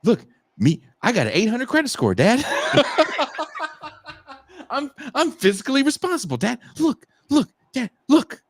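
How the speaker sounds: put-on high, whiny childish plea; grown daughter mimicking a kid. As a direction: beg for attention like a little kid. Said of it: "my daughter's like".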